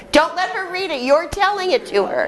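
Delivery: high teacher call across the room; calling out a correction. Real, not acted. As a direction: catch a player breaking the rules.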